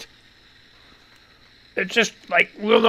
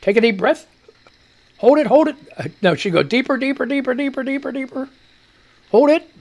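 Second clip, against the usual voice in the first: coaching nurse voice, higher, pushing on the hold; imitating the nurse; 6 semitones higher, faster than usual. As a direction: egg the patient on like a test nurse, mock urgent. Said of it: "Then she'd say".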